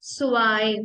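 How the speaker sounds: स्वाई said "svai" with the w softened to v; teacher models स्वाई clearly; स्वाई repeated for learners; German zwei. Shown as a demonstration. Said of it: "same to स्वाई".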